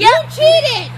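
high, very loud protest; loud accusation. Real, not acted. Player insisting the child cheated, loud accusation. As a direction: shout it at the cheat.

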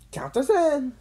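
high-pitched, loud shout. A narrator's reading. Friend joining Jimmy's adventure, shouting. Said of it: "Gene shouted".

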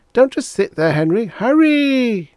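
very high, loud, impatient command; wife orders Henry to hurry up; Agnes nagging. Acted. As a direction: snap him into motion. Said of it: "Agnes continued".

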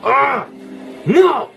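very high, startled scream; panicked yell. Real, not acted. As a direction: cry out as the blow lands. Hit by monster in game.

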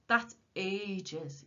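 drawn-out moan of complaint. A narrator's reading. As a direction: moan about it, a little loudly. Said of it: "he moaned".

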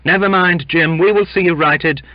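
high, loud rallying call; crowd's cry. A narrator's reading. Crewmen backing their sick mate, crying out. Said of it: "cried several together".

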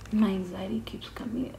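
hushed nervous whisper. Real, not acted. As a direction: whisper it under your breath. Nervous whisper before dental work.

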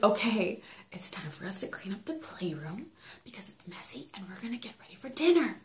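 put-on hushed parent whispering to kids; breathy, unvoiced whisper. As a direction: whisper the instructions as if sharing a secret. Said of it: "suggestions is to whisper".

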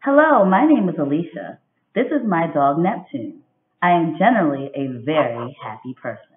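expressive picture-book read-aloud; reading to children. A narrator's reading. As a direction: read it like storytime for young kids. Teacher reads picture book aloud.

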